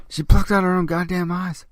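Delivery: loud, high horrified punchline; shocked loud. Real, not acted. Telling viewers the grisly ending, a loud shocked blurt.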